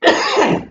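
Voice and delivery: sudden hacking cough. Real, not acted. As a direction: break into a cough. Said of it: "I do apologise for my coughing".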